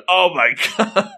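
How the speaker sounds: high-pitched, restrained comic scream; mock shocked wail. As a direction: scream in playful horror without much volume. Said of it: "He's screaming".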